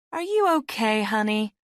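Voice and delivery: high-pitched, solicitous woman's voice; concerned older woman. A narrator's reading. Middle-aged woman asking a bedraggled stranger, concerned. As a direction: check on her in a high, motherly voice.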